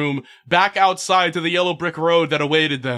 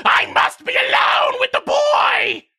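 screaming princess; slower than usual. Acted. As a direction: scream it in a tantrum. Princess screams after throwing chair.

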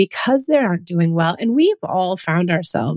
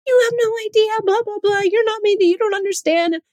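put-on much higher, whiny upset child ranting at mom; mock kid's tirade; 12 semitones higher than usual. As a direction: mimic a daughter going off in a tantrum. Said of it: "she'll go in some tirade".

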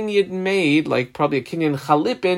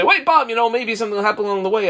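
calling out; 8 semitones higher, much faster than usual. Retold or imitated. Calling Bob back in example.

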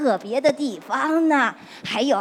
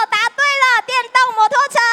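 put-on high, louder cheer for a child; excited praise; 11 semitones higher, 7 dB louder, much faster than usual. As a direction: celebrate the right answer. Praising a right answer. (兴奋地夸奖)

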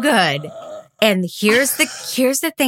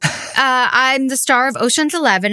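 high-pitched, hesitant self-introduction; Tommy Metz; 7 semitones higher than usual. Retold or imitated. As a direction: imitate a friend's celebrity-game opener, pitched up. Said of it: "picks up the thing and goes".

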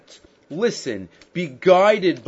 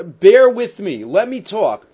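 pleading; 6 semitones higher, 8 dB louder, slower than usual. Retold or imitated. Voicing Eov asking to speak.

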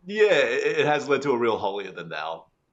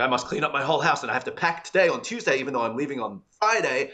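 frantic inner monologue; 6 semitones higher, 4 dB louder than usual. Retold or imitated. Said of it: "And I'm like".

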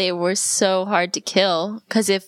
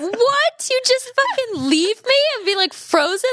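high, shrill shocked outrage; outraged disbelief; 13 semitones higher than usual. Real, not acted. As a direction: react like you've been betrayed. Outraged at partner's quick answer.

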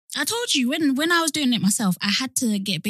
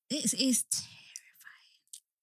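slow, drawn-out hushed whisper; dramatic whisper; 3 semitones higher, 9 dB quieter, much slower than usual. Real, not acted. Co-host warns about the dating streets, dramatic slow whisper. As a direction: whisper it with long drawn-out words. Mock dread about men.

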